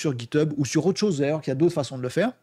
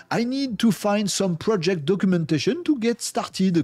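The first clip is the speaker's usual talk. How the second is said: English read out with a heavy French accent, a bit slow; halting English with a French accent; 3 semitones higher, slower than usual. Real, not acted. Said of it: "pardon pour mon accent, euh, anglais, euh, pitoyable".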